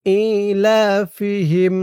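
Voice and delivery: ইলাফিহিম slow, vowels stretched, rising; tajweed recitation. Shown as a demonstration. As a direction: draw out ইলাফিহিম chant-like. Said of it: "খেয়াল করবেন আমি কোথায় কেমন উচ্চারণ করি". (ইলাফিহিম ধীরে টেনে তিলাওয়াত)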